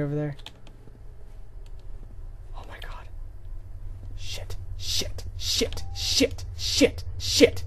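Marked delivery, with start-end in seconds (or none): 2.6-6.4 hushed rapid swearing; panicked whisper. Real, not acted. Gamer spotting the chainsaw killer, panicked whisper. 6.6-7.6 high, sharp panicked cry; panicked yelp. Real, not acted. Gamer spotting the chainsaw killer, panic bursting out.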